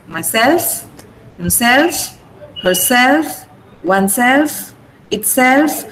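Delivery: "myself, himself, herself, oneself, itself", each rising on SELF; stress on SELF. Shown as a demonstration. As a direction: push SELF in "myself" through "itself", list intonation. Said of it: "I'm stressing self".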